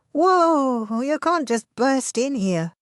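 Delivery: high, startled cry; startled exclaim. A narrator's reading. Man caught mid push-up by his sister, startled. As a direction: blurt it out, caught off guard. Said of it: "he exclaimed".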